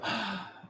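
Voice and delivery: a sharp high gasp of shock; shocked elves gasp. Acted. GM voicing the elves shocked by the human: high gasp. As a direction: gasp like a crowd of startled elves. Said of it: "They just go".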